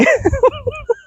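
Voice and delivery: soft high-pitched giggle; helpless laugh. Real, not acted. Laughing about her dog.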